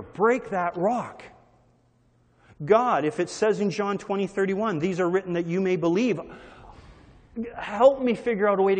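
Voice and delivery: a passionate spoken prayer; earnest prayer. Shown as a demonstration. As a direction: pray it out with urgency. Modelling a prayer aloud.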